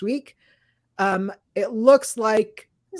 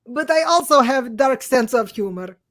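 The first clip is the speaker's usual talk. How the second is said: slightly raised, put-on Russian accent; 3 semitones higher, 4 dB louder than usual. Co-host reads listener's five-star review, put-on Russian accent. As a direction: read it out in a hammy Russian accent. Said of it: "as though this is how I talk".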